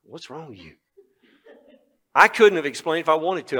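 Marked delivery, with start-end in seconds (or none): 0.0-0.8 quiet. Retold or imitated. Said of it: "and she said".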